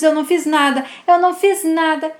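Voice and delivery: a boy sobbing out his innocence, acted; loud mock crying. A narrator's reading. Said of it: "chorando, diz".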